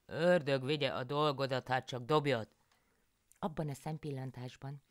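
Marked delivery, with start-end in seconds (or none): 0.0-2.5 louder, higher exasperated giving in; louder exasperated husband; annoyed husband János. A narrator's reading. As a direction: curse her and let her do it.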